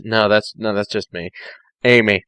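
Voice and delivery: ordinary talk, nothing marked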